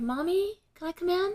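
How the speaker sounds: put-on high-pitched child asking; little daughter. Daughter asks mother at the door, childlike.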